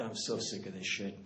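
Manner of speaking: weary mutter. Retold or imitated. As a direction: drop it under your breath, worn out. Fed-up reply to politicians.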